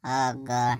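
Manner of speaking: ordinary delivery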